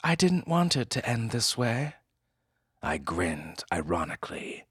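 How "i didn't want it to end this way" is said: regretful woman. Acted. Woman with pistol.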